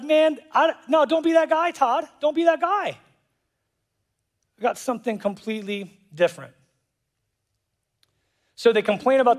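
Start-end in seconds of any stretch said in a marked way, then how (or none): 0.0-6.5 God chiding, pitched much higher; playful scolding voice for God telling Todd off; God's voice. Retold or imitated. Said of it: "and God's like".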